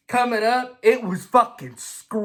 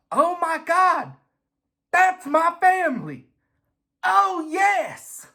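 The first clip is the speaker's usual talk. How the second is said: much higher, slow, overjoyed gushing; proud delight; 9 semitones higher, slower than usual. Retold or imitated. Dale, thrilled his grandsons share the family gene, high gushing delight. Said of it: "this guy was like".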